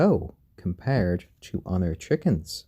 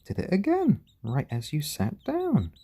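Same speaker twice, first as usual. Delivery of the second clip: delighted; 7 semitones higher than usual. Real, not acted. Delighted reaction to the chicken.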